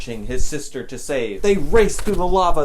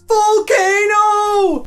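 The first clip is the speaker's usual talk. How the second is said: very high, slow shout; 8 dB louder, much slower than usual. A narrator's reading. Steve shouting over the noise, long loud cry. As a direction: yell the warning. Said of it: "Steve shouted".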